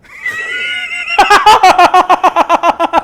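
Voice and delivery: high screaming laugh; loud shrieking laugh. Real, not acted. Cracking up after the joke.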